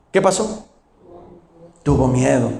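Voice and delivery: ordinary talk, nothing marked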